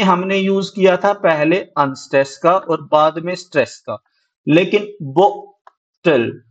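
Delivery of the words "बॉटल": "बॉटल" slowly split, weight on the first syllable बॉ; stress on बॉ. Shown as a demonstration. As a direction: lean on बॉ in "बॉटल", pause, then an unforced टल. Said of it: "sound stress किस पे दे रहे हैं". ("बॉटल" में बॉ पर ज़ोर, धीरे तोड़कर)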